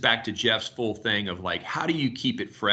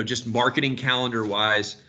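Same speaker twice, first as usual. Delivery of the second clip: ordinary delivery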